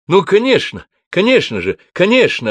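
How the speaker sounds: a host loudly delighted his guests came; overjoyed. A narrator's reading. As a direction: burst out with delight. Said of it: "обрадованно сказал он".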